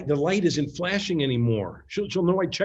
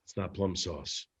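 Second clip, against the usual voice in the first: hushed verdict; 8 semitones lower, 6 dB quieter than usual. Acted. Doug inspects the sauce.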